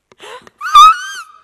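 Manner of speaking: sudden loud gasp of excitement; excited gasp. Shown as a demonstration. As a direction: gasp loudly like a wide-eyed visual person. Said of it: "they speak really like this".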